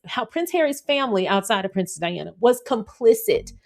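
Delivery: ordinary talk, nothing marked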